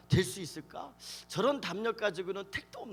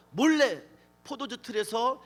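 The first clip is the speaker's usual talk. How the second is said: usual speaking voice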